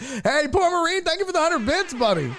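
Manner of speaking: high-pitched streamer shout-out; hollered thanks. Pretend.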